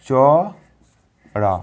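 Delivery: very slow, stretched চড়া; drawn out. Shown as a demonstration. Teacher sounding out মন চড়া for pupils, slowly. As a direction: pull চড়া out long. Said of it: "দেখো এটা দেখো মন চড়া".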